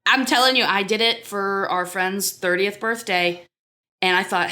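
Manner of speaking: normal talking voice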